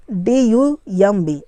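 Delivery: slow letter-by-letter spelling; spaced-out letters. Shown as a demonstration. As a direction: spell it slowly for learners. Spelling a word aloud for learners.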